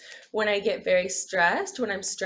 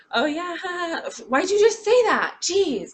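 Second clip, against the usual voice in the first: loud, high, irritated snapping; mock moody snap; 10 semitones higher, 8 dB louder than usual. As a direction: bite back at someone in a mock huff. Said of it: "quick to snap".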